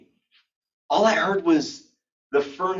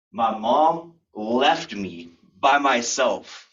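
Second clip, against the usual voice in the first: drawn out word by word; slow, weighty statement; 5 semitones lower, 4 dB louder, much slower than usual. Real, not acted. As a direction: land each phrase with dramatic pauses. Slow dramatic telling of abandonment.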